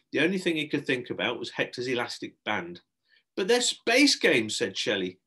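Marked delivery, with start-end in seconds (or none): 3.4-4.6 high, loud, drawn-out surprise; slow, incredulous sales pitch; taken aback. Acted. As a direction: protest as if astonished. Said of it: "taken aback".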